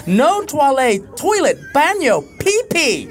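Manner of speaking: desperate tourist, pitch climbing; desperate pleading. Retold or imitated. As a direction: beg for the toilet in every language. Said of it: "And when I said".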